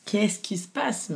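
anxious repeated question. Retold or imitated. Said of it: "je pleure, en fait".

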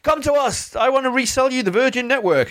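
high, sing-song sales pitch, well above her own voice; luring passers-by in, high and coaxing; pushy salesperson. Retold or imitated. Mocking the expo sellers calling her over, high sing-song pitch.

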